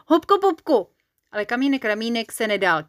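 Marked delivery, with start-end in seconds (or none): 0.1-0.9 high, loud, insistent shout; excited high-pitched outburst; racing to outdo. A narrator's reading. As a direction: blurt it out loudly to beat the other one. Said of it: "aby Kamínka předběhl".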